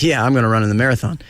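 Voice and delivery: quick, offhand joking claim; facetious. Retold or imitated. Guest replaying his joke to the paper, facetious and quick. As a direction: toss it off fast, not meaning it. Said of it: "you say it facetiously".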